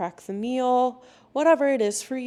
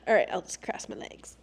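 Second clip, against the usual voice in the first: under-the-breath whispery aside; half-whispered self-talk. Real, not acted. Aside while settling herself.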